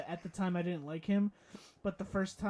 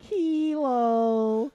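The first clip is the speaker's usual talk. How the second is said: video guy impression; 4 semitones higher, 8 dB louder than usual. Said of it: "The guy who'd be like".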